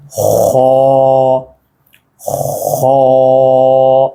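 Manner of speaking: खौ with a rasped kh and a long rounded vowel; rounded mouth. Shown as a demonstration. Teacher models the seventh letter, खौ rounded and held long. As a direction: round the lips and hold खौ on a level note. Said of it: "मुंह को इतना गोल कर रहा".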